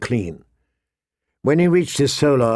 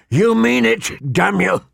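much higher furious curse; 8 semitones higher than usual. Acted. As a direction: spit it out in fury. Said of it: "barely contain his fury".

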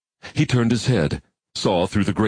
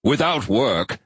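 forceful; 4 semitones higher, slower than usual. A narrator's reading. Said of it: "in a somewhat more forceful voice".

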